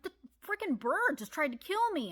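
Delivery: mock yell of outrage at mom; exasperated shout; indignant yell. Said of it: "I'm like".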